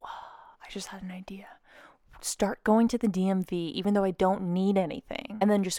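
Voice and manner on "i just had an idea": breathy whisper, sudden idea. Real, not acted. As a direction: whisper it like a secret.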